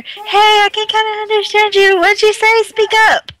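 shouting loud and high into the water; yelling. Acted. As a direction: yell out over the storm. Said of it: "I yell into the water".

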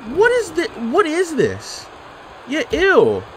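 high disgusted disbelief; grossed out. Real, not acted. Gamer watching a cheap submission, high disgusted squeal. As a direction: squeak it out, appalled.

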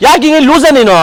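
mock-furious telling-off; acted scolding of the friend who fed the sweet; angry scolding. Scolds friend in staged panic.

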